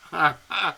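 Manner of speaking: short, loud burst of laughter; excited laugh. Real, not acted. Host reacting to the surprise booking, excited laughing. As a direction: laugh out in happy disbelief.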